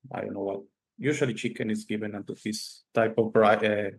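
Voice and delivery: ordinary talk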